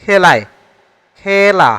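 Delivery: "খেলা" with open æ, as khæla; open e pronunciation. Shown as a demonstration. Teacher shows the open vowel example, "খেলা" as khæla. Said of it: "এ উচ্চারণ হয় এখানে".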